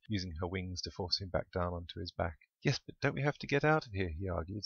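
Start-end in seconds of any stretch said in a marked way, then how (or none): 2.6-4.1 higher, whiny little complaint; a little petulant. A narrator's reading. Duck arguing with his partner, a little petulant. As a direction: sulk a bit as you argue. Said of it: "sounding a little petulant".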